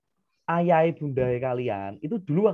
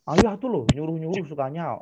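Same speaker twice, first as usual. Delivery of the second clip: put-on whiny child. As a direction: whine it back at dad. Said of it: "Terus gini dia".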